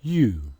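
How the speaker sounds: U with lips puckered; demo sound. Shown as a demonstration. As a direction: round the lips for a clear U. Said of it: "pronounce this letter".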